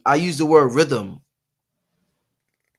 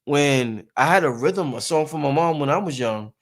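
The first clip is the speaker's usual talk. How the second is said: ordinary delivery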